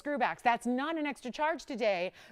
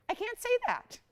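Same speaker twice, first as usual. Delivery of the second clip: much higher, insistent denial; emphatic refusal; 12 semitones higher than usual. Real, not acted. Host telling viewers what she can't say, pitched high and emphatic. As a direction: stress that it's off limits. Host stressing what's forbidden.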